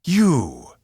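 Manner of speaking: seething, loud and high. A narrator's reading. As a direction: spit the word out in rage. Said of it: "Zenfor seethed".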